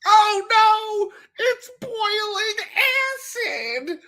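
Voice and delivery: high, slow, hammed-up panic; overacted squeal of alarm; bad acting. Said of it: "my favorite line deliveries".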